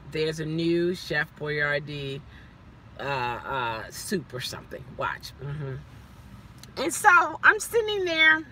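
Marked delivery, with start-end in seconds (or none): 0.1-5.7 sly low aside about the salesman; low mocking voice. Mocking the salesman's upsell, low and hushed.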